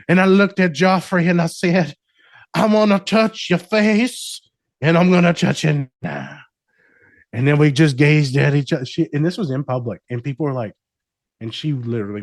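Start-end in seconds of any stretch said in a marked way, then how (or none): 0.1-8.8 Southern romance narrator. Pretend. Therapist narrates client's love life, hammy Southern romance voice. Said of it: "a Southern, like, romance novel".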